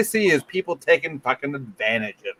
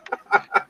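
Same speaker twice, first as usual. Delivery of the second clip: high burst of laughter. Real, not acted. Laughs at own accent.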